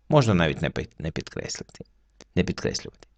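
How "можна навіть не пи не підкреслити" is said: ordinary delivery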